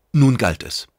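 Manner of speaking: ordinary delivery, nothing marked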